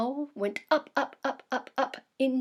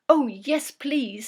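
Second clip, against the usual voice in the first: a louder, lightly voiced eager yes; eager beaver; 3 semitones higher, 9 dB louder than usual. Beaver accepting owl's offer to fly again, eager. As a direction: jump at the offer.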